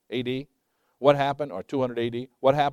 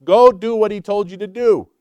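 higher and louder, urgent prodding; preacher voicing the angel scolding the idle apostles, higher and louder; angel's rebuke; 7 semitones higher, 10 dB louder than usual. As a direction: shake them up like an impatient angel. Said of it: "Paraphrase, but that's what he said".

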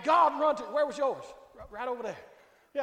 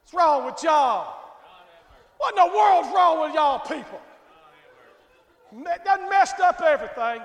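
mock scolding; 4 semitones higher, 9 dB louder than usual. Preacher teasing the congregation with a loud rebuke. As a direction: bellow it like a mock-outraged preacher.

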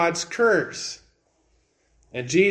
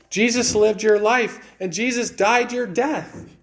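emphatic preaching; 5 semitones higher than usual. Acted. Preacher builds to a peak.

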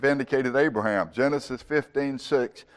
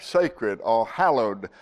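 ordinary talk

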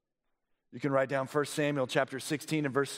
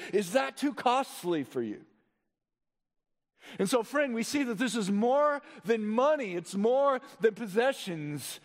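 higher-pitched, urgent sermon delivery; impassioned preaching; 10 semitones higher, 3 dB louder than usual. Real, not acted. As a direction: press the congregation with rising conviction. Preaches at full force.

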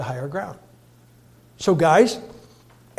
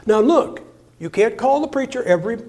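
usual speaking voice

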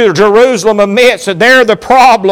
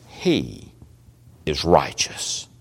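whispered; 13 dB quieter, slower than usual. Acted. Hushed dramatic sermon climax.

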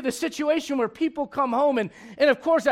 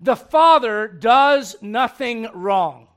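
slow emphatic; 3 semitones lower, 4 dB louder, much slower than usual. Acted. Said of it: "let me just start off by simply saying this".

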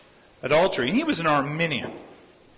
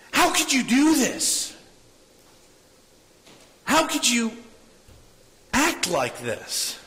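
high, strained, exasperated cry; retold pastor venting frustration; frustrated outburst; 8 semitones higher than usual. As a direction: plead in disbelief at a friend's failure. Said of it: "he gets so frustrated".

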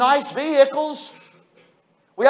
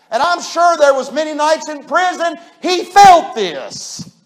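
usual speaking voice

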